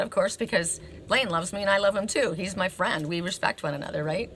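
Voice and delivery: ordinary delivery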